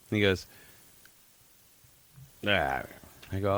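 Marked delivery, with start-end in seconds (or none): 2.5-2.8 mumbled imitation of a wordless reply; mock a buddy's unintelligible mumble; blended mumble. Drunk buddy answers a simple question, mumbled and unintelligible. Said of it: "two words that are coming out of his mouth".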